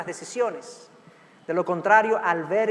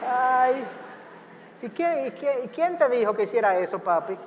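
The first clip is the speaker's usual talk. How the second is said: high-pitched, hammed-up shock at the ruined seats; mock dismay; 4 semitones higher than usual. Father to his son who drew on the car seats, mock dismayed. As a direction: feign horror, half laughing. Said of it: "Y yo".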